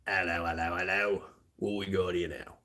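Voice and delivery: hammed-up British bobby-style patter, h's dropped; deliberately terrible British accent; bad British accent. Shown as a demonstration. Streamer offering viewers bad ones, mock British accent. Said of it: "really want bad ones".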